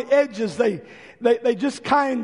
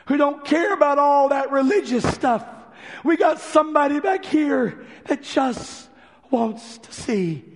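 calling out; 4 semitones higher than usual. Retold or imitated. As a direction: call the disciples from the back. Calling out across the church.

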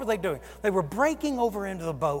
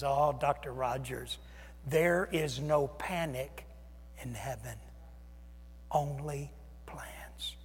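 soft, slow, down to a whisper; gentle elder's hushed reassurance; hushed calm reply; 6 semitones lower, 9 dB quieter, slower than usual. Retold or imitated. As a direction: settle him with a quiet, slow certainty. Corrie ten Boom's calm answer.

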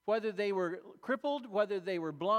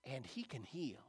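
inner thought; 5 semitones lower, 7 dB quieter than usual. Retold or imitated. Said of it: "he thinks".

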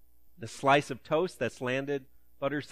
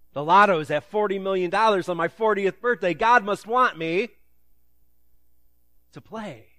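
higher and louder, giddy with the idea; mock excitement; 6 semitones higher, 9 dB louder than usual. A lotto player convincing himself God wants him to play, mock giddy. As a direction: sell yourself on the sign. Imitating someone reading signs.

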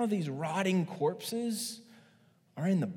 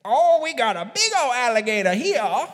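Cajun impression; 6 semitones higher, 10 dB louder than usual. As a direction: gloat over the catch in a Cajun accent. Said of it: "He lifts it up".

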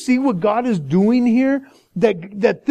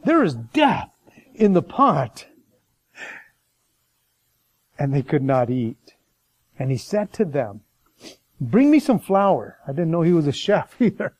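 scripture reading; 7 semitones lower than usual. Acted. Reads scripture passage aloud.

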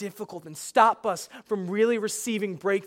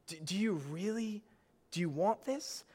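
gentle question; 10 dB quieter than usual. Retold or imitated. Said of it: "I think he's asking the man".

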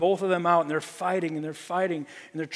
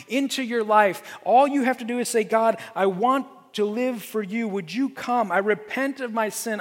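raised, urgent preacher's appeal; impassioned preaching; 4 semitones higher, 3 dB louder than usual. Acted. Pastor urging the congregation to invite Jesus in, impassioned preaching. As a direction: press the call home with growing force.

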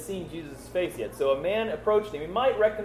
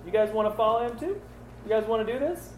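snarky; 7 semitones higher than usual. Retold or imitated. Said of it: "a little snarky in that".

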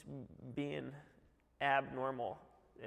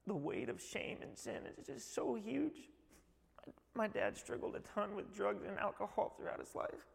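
tearful; 7 semitones higher than usual. Real, not acted. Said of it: "I'm almost out of the tear part".

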